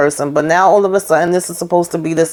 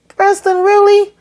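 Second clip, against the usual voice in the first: very high, incredulous squeal of a question; high-pitched disbelief. Acted. Reviewer reacting to Preston's silly question, high-pitched incredulity.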